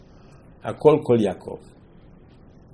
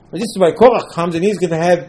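ordinary delivery

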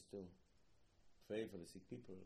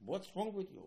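higher, louder scolding question; mock rebuke; 11 semitones higher, 8 dB louder than usual. Adam rebuked for wanting everything, raised voice. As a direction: scold him sharply.

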